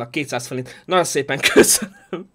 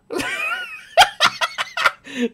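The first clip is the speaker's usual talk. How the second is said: screaming laugh. Real, not acted. Bursts out laughing at own joke.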